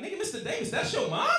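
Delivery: put-on high, squeaky teasing kid voice, laughing; kids mocking. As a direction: imitate students gawking in disbelief. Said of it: "Kids looking at me like".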